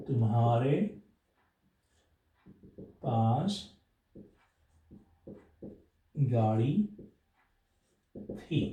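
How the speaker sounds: each word spaced out with long pauses; slow word-by-word dictation. Shown as a demonstration. Teacher dictating the Hindi sentence word by word. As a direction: dictate slowly to students, one word at a time.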